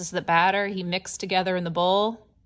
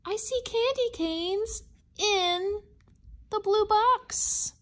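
high, slow wonder at the find; surprised delight; 10 semitones higher, slower than usual. Acted. Reader showing children the candy canes with surprised delight. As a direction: gasp and marvel at the candy canes. Surprise reveal opening box.

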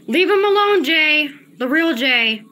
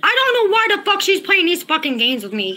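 usual speaking voice

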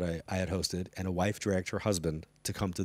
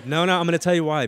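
much higher and louder, cutting in; emphatic insistence; 10 semitones higher, 12 dB louder than usual. Real, not acted. Guest defending a surprising celebrity pick to interviewer, emphatic insistence. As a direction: jump in to defend your answer. Cuts in to justify surprising answer.

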